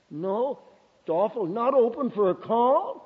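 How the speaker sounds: imitated disbelief, ending on a high rise; incredulous questioning. Caller taken aback by the preacher's refusal, incredulous. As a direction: echo the refusal in mock shock. Acts out caller's shocked reply.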